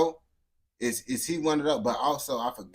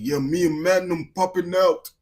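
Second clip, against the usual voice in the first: louder, higher-pitched British roadman impression; mock British accent; 5 semitones higher, 6 dB louder than usual. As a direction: put on a big British roadman voice. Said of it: "that got the deep voice".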